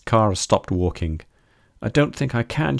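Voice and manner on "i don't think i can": higher-pitched despairing wail. A narrator's reading. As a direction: wail it as if giving up. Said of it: "she wailed".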